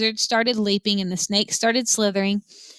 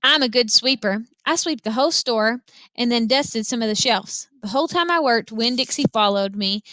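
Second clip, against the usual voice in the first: ordinary delivery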